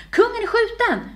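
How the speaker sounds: very high, loud scream of alarm; aghast, piercing announcement; horrified cry. A narrator's reading. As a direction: raise the alarm in horror. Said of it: "utbrast hon förfärat".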